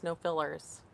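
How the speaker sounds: usual speaking voice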